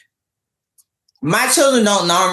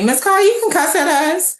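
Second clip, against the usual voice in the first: high, pleading student voice; lightly mimicking kids begging her; imitating her students; 12 semitones higher than usual. Students begging their teacher to cuss, high pleading. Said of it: "my students used to tell me".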